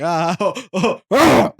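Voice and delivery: hammed-up discovery, oh's climbing high and loud; Borat's realization. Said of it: "So he says, he's like".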